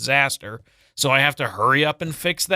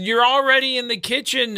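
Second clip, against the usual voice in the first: retold daughter yelling; 10 semitones higher than usual. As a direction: yell it from the next room. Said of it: "And she said".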